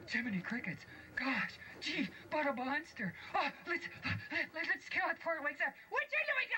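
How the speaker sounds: high, panicky dwarf voice; frightened dwarf. Acted. Dwarfs seeing a monster on the bed, high and panicky.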